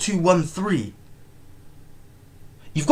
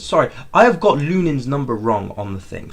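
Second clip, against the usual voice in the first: ordinary talk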